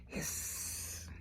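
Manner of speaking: breathy voiceless hiss; a soft drawn-out hissing sound; whispered hiss. A narrator's reading. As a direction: hiss it out like a dinosaur. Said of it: "came a loud hiss".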